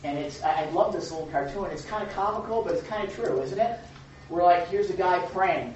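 ordinary talk

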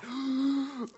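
exaggerated high, drawn-in gasp; acted-out gasp before an outburst. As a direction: suck in air in mock outrage. Acting out the angry man's reaction.

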